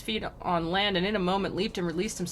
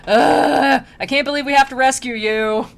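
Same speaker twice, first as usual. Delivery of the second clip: higher, loud exasperated grumbling; mock disgusted groan; 5 semitones higher, 9 dB louder than usual. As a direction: groan it like a put-upon rescuer. Acted grudging rescuer.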